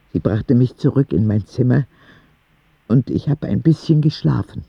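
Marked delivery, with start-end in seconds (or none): none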